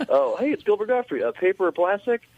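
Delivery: high, chirpy checkout greeting, acted; store cashier. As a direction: play a cashier recognizing a celebrity. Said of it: "without someone being like".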